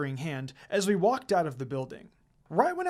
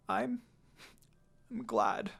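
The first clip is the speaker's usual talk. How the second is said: between sobs; 4 semitones higher, 5 dB quieter, slower than usual. Acted. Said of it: "I said in between my sobs".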